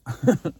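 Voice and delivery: a quick real laugh at herself; short laugh. Real, not acted. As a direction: burst into a brief laugh. Laughs at own story.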